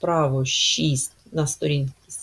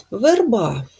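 верба, said higher and louder; emphasized test word; 4 semitones higher, 6 dB louder than usual. Shown as a demonstration. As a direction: present верба clearly for checking. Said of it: "Е чи И?". (чітко вимовити слово верба)

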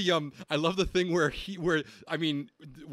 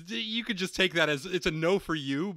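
ordinary delivery

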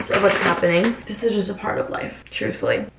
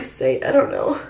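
ordinary talk, nothing marked

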